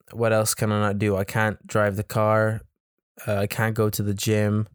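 usual speaking voice

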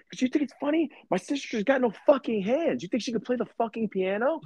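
high, outraged mock rant; imitating furious boss. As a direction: play the boss defending his sister. Boss yelling about sister.

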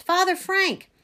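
high-pitched urgent call; relieved cry. A narrator's reading. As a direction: cry out to get their attention. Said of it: "I cried".

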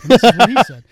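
loud triumphant reveal; gleeful announcement. Retold or imitated. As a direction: announce it loudly with glee. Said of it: "I'm like".

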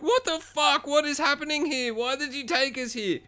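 hammed-up high-pitched girly freak-out; freaking-out girls. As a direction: imitate panicked girls in a thin high squeal. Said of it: "they're all freaking out".

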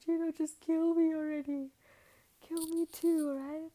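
loud, high mock despairing shout; losing it. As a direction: act out a hammed-up desperate yell. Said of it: "Tony loses his shit".

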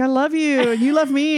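put-on high, emphatic teen pleading; hammed-up retold protest; mock lovelorn insistence. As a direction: insist on it like a lovesick teenager. Acted teen insisting in quarrel.

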